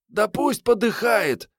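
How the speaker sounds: put-on high, slow girl's voice; callous girl. A narrator's reading. Girl wishes the wounded man dead, high callous voice. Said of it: "сказала Мариетта".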